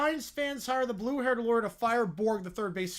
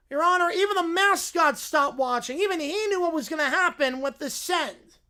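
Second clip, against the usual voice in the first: louder, higher courtroom lawyer; blue-haired lawyer; 6 semitones higher, 6 dB louder than usual. Acted. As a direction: present the evidence grandly. Lawyer argues.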